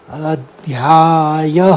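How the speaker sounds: अध्यायः very slow, ending in an audible ह; अध्यायः with the visarga ह pronounced; visarga sounded. Shown as a demonstration. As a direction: draw out अध्यायः and finish on ह. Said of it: "ये उच्चारण कहा जाएगा".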